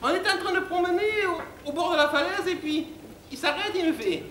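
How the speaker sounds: high falsetto, plaintive and over-dramatic; bimbo recounting tragedy. Acted. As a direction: mourn in a silly high bimbo voice. Bimbo narrates the cliff scene. (voix aiguë de bimbo éplorée)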